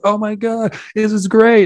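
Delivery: freaking out over a cow, hammed up; excited. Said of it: "And they're like".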